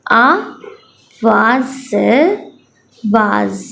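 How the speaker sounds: slow syllables with long pauses; teacher reading for children to echo; phonics reading drill. Shown as a demonstration. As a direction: read slowly so children repeat behind you. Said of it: "मैं दोबारा से पढ़ती हूँ".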